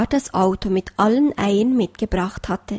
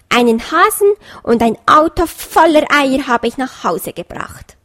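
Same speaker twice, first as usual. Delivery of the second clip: smug fox boasting; 4 semitones higher, 6 dB louder than usual. A narrator's reading.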